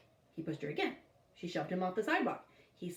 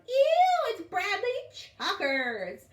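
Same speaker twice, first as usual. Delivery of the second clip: put-on much higher, louder, slow girl's squeal; disgusted girl; 12 semitones higher, 7 dB louder, much slower than usual. A narrator's reading.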